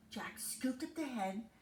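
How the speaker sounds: plain reading voice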